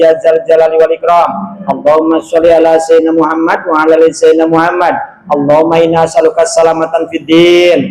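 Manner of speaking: steady, even Arabic prayer recitation; closing prayer. Real, not acted. Preacher closing the study circle with a prayer for the congregation, calm and measured. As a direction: recite the supplication in a calm, level chant.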